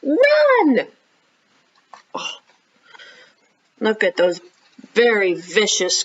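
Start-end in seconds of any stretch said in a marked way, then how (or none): 0.0-0.9 high loud shout; shouted run. Acted. Rhonda yells at Gavin to flee, loud high shout. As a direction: yell it to get everyone moving. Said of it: "she yelled".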